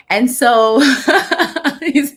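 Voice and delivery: loud real laugh breaking in. Real, not acted. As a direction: laugh hard mid-sentence. Laughs about her husband.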